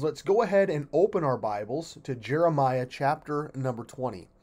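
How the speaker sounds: ordinary talk, nothing marked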